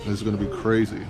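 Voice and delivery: usual speaking voice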